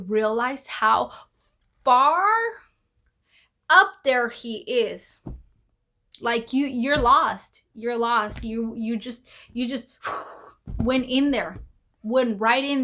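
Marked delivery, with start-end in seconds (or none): none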